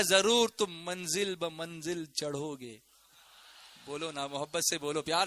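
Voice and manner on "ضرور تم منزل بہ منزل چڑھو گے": slow, measured declamation; solemn recitation. Real, not acted. Preacher reveals the translation to congregation, solemn and slow. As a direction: draw out each word with weight.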